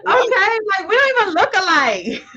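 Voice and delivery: giggling disbelief; laughing it off with sister. Real, not acted. Joking about being called twins.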